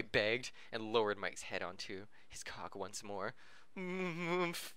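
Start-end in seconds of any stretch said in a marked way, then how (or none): 3.8-4.7 muffled moan. Acted.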